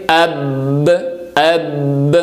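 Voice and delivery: اب with a short hold and then an echoing ب release; pause then qalqala. Shown as a demonstration. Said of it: "تھوڑا سا رک کر ... قلقلے کی آواز". (اب میں ب پر رک کر قلقلہ کی گونج)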